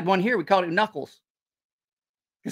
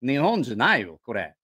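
quoting him in Japanese, scoffing; a grumpy father-in-law in Japanese; 日本語で不満げに; 3 semitones lower than usual. Retold or imitated. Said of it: "my father-in-law was like".

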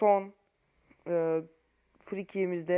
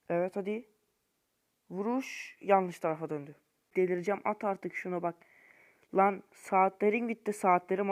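ordinary talk

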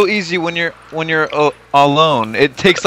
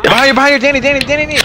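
urgent warning; 9 semitones higher, much faster than usual. Real, not acted. Warning teammate of boss.

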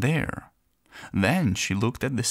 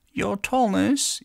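much higher, slow little squeak; squeaky tiny voice; 8 semitones higher, slower than usual. Acted. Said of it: "it squeaked".

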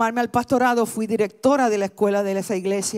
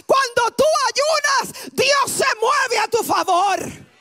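much higher, shouted sermon climax; full-force preaching; 12 semitones higher, 3 dB louder than usual. Acted. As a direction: preach it out to the whole room, full throttle. (predicación a todo pulmón, gritando)

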